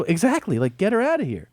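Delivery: high-pitched, indignant comeback; retold exasperated retort.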